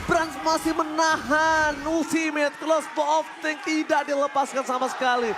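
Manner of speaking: loud, high hyped play-by-play; esports caster. Acted. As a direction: call the fight at full hype. Hyped play-by-play of Lord fight.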